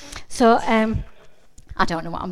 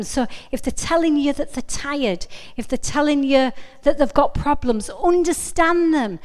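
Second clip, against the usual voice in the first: ordinary talk, nothing marked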